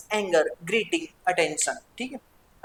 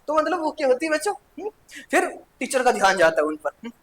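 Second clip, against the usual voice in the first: normal talking voice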